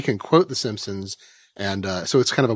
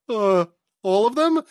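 higher, drawn-out hesitant answer; stall, then answer slowly in a higher voice; Homer; 8 semitones higher, slower than usual. Acted. Homer answering the Germans about his initiatives, in a slow cartoon voice. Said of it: "Homer, uh, safety inspector".

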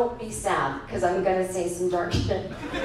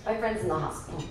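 subdued; 4 semitones lower, 6 dB quieter, faster than usual. Retold or imitated. Grieving customer answering the clerk.

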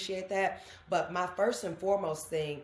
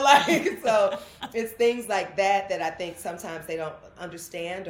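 ordinary delivery

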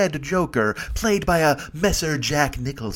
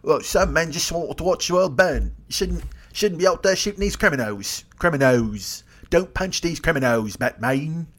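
higher, slow, put-on old butler voice; butler Alfred; 3 semitones higher than usual. Alfred lecturing Batman about criminals, put-on fussy butler voice. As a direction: fuss over the master like a doting butler. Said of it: "bring him creme brulee in the Batcave and go".

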